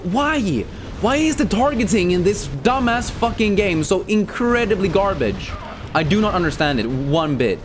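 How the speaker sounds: high, exasperated outburst; angry gamer rant. Real, not acted. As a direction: rage at the broken game. Raging at game targeting.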